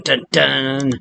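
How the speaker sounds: ordinary delivery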